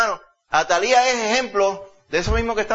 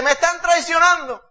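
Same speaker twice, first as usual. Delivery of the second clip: high mock cry of betrayal; preacher mimics the queen's high indignant wail; Atalía crying betrayal; 12 semitones higher, 3 dB louder than usual. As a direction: play the treacherous queen crying foul, pitched up high.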